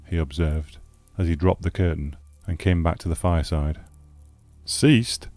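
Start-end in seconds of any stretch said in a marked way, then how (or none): 4.7-5.3 sharp, higher, eager "Ceased?"; exclaiming. Acted. Stranded traveller hearing the snow has stopped, exclaiming. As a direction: leap on the word with hope. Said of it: "I exclaimed".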